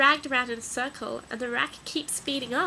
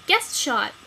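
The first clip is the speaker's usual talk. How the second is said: a loud call of the magic words; yell; 8 dB louder than usual. Acted. Wally yells the magic words to vanish. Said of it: "Then he yells".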